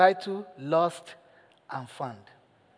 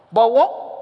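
ordinary talk

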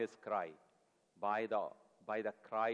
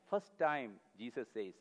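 ordinary talk

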